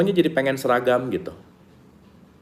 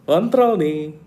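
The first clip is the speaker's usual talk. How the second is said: mock commanding; 6 semitones higher, much slower than usual. Mocking the reactive mind.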